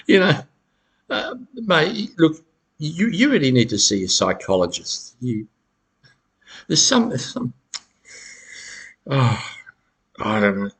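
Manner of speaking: mock dismissive. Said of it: "They'll try to re-gaslight you and say".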